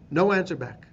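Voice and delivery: ordinary talk, nothing marked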